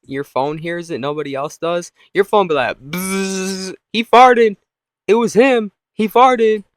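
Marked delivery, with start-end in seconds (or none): 2.8-3.7 long voiced buzzing noise; drawn-out "bzzz"; phone buzz. 3.9-6.6 loud, high accusing shouts; mock public tattling at full volume; tattling phone.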